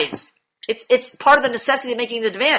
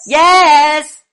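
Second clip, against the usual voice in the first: loud, commanding yes; demanding shout; 4 semitones higher, 9 dB louder than usual. Shown as a demonstration. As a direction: bark it at him to wake him up. Teacher drilling student's yes.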